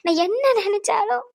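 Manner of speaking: anguished cries of lament; tearful wailing. Acted. Mother begging God to spare her child trouble, wailing. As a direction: wail and plead with God, dragging the last words. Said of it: "இந்த மாதிரி ரொம்ப அழுகாதீங்க".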